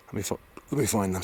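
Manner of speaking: panting, out of breath from the climb; breathless, words rushed between breaths. Real, not acted. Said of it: "Sorry, I'm out of breath".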